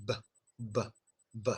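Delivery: b with lips closed and released; full B. Shown as a demonstration. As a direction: complete b, popped. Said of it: "release it".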